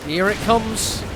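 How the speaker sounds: excited esports casting; live play-by-play. Acted. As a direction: call the match fast and hyped.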